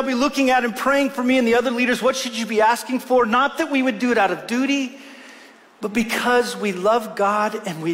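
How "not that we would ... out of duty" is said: normal talking voice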